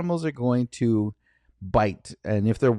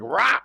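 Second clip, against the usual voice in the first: short, higher mock dog lunge; imitated dog snarl; 6 semitones higher than usual. Dog snapping at a man's head, imitated snarl. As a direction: let out a sudden dog roar. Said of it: "the dog was like".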